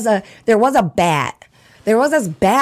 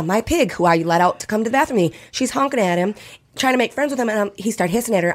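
ordinary talk, nothing marked

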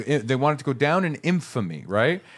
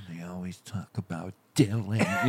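low, soft sulky grumble; mock jealous grumble; 6 semitones lower, 7 dB quieter than usual. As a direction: grumble like the overlooked one of a pair. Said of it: "Looking over at Dylan going".

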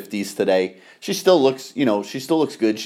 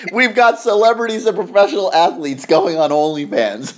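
laughing; 8 semitones higher, 4 dB louder than usual. Real, not acted. Laughing at celebrities on OnlyFans.

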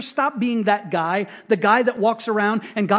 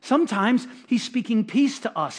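ordinary talk, nothing marked

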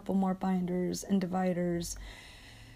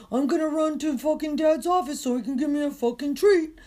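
mocking, higher-pitched spoiled boy voice; entitled dog voice; 6 semitones higher, 3 dB louder than usual. Ranting walker mimics the entitled husky, mocking high voice. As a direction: put on a bratty dog running to dad. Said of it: "he's an entitled fucking boy".

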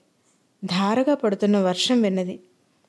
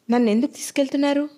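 quick, higher-pitched questioning; narrated captive's brave question, raised pitch; plucking up courage; 5 semitones higher, faster than usual. A narrator's reading. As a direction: ask with mustered courage. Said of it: "అడిగింది నిరుపమ ధైర్యం కూడా తీసుకుని".

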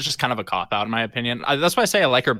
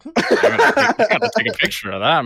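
imitated dad; 11 semitones higher, 4 dB louder than usual. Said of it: "the way he said it".

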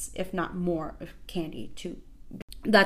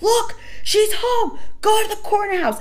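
put-on kids screaming; 14 semitones higher, 8 dB louder than usual. Kids spotting her car screaming it out high and loud. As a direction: shout it like kids spotting a celebrity. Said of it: "they're screaming".

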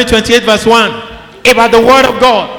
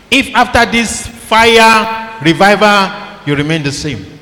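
ordinary talk

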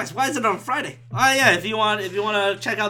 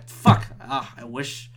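ordinary talk, nothing marked

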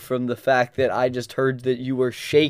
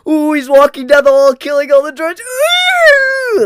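much higher, louder breathless fanboy gush; mock excited squealing; 13 semitones higher, 7 dB louder than usual. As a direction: squeal through the play-by-play like a giddy kid. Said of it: "that's what you sound like".

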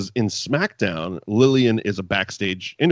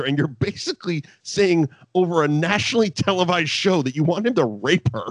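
usual speaking voice

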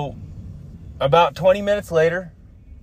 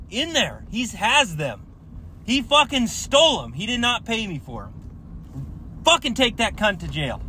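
frustrated rant; 7 semitones higher than usual. Pretend. Imagined furious plea to police.

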